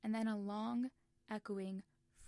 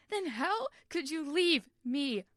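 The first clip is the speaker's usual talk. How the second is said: much louder, higher, slow, breaking; abandoned boy howling at Will; anguished cry; 7 semitones higher, 13 dB louder, slower than usual. Acted. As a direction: scream it through tears. Tommy's crying outburst.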